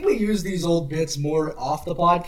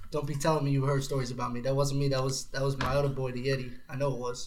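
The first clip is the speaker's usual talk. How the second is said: lower, quieter street-talk Sasquatch; low gruff Sasquatch voice; 6 semitones lower, 10 dB quieter than usual. Acted. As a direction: play it low and cool. Sasquatch character talking.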